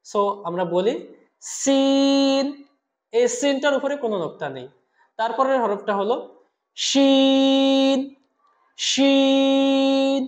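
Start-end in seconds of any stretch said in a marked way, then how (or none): 1.4-2.4 সিন chanted with a long level vowel; elongated letter. Shown as a demonstration. Teacher asks students to repeat, সিন drawn out. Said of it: "আমরা তিন আলিফ টানবো". 6.8-10.2 শিন chanted with a long level vowel; elongated letter. Shown as a demonstration. Teacher models for repetition, শিন drawn out. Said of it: "বলুন আমার সাথে".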